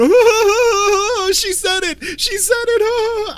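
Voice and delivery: very high, squealing mock laughter; gushing audience member. Mocking whoever in the audience loved the line, shrieky fake delight. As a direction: mimic an over-delighted moviegoer cackling at a callback. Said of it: "somebody in the audience that went".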